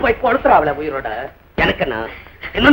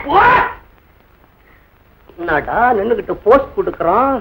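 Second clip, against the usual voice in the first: normal talking voice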